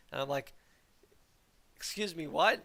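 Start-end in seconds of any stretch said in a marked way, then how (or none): 1.8-2.6 retold taken-aback question, lifted in pitch; mock disbelief. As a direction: act out a puzzled double take. Retells his disbelief at classmates' remark.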